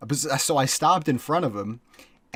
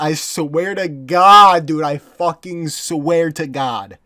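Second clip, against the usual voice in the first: insistent; 3 semitones higher, 8 dB louder, much slower than usual. Real, not acted. Insisting on truth of story.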